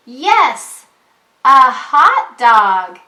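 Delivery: loud, excited call; Jim yelling. Acted. Teacher reading Jim's line to a child, yelling. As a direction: shout it out like Jim at the picnic. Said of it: "yells Jim".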